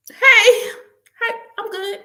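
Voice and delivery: nervous high-pitched hello; flustered hi. Retold or imitated. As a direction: squeak it out flustered. Flustered greeting to crush.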